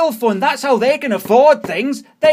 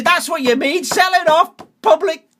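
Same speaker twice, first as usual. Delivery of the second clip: ordinary talk, nothing marked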